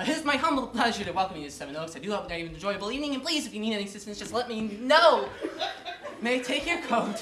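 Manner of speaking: fast, gabbled welcome; rushed greeting. Acted. Weary butler rattling off the same welcome, fast. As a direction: race through the welcome speech.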